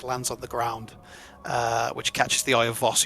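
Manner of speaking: ordinary talk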